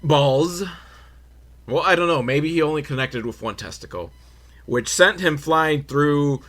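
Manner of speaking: usual speaking voice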